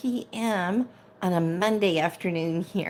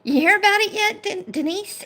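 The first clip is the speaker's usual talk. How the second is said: teasing; 13 semitones higher, 3 dB louder than usual. Real, not acted. Teasing a viewer.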